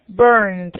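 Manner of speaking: "burned" as one syllable, ending in a D; final -ed as D. Shown as a demonstration. Teacher models the past-tense ending for students, "burned" with final D. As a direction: stretch "burned" and close it on D. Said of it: "se pronuncia como una D".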